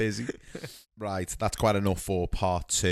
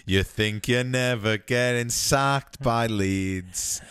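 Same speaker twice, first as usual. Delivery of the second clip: slow teasing; much slower than usual. Pretend. Teasing, drawn out.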